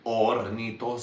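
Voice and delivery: ordinary delivery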